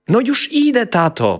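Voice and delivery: put-on high, loud childish reply; little boy voice. A narrator's reading. Boy answering his father's call. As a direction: call back like a hurried kid.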